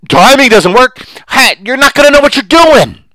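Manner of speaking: really fired up now, pitch climbing; getting worked up. Real, not acted. Host ranting against the stock selling industry, really worked up. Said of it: "And I'm getting worked up".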